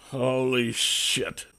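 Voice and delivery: soft slow mumble; mumbling. A narrator's reading. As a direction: mutter it under your breath. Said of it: "I mumbled".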